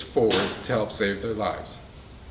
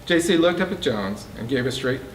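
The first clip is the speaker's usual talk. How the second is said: plain reading voice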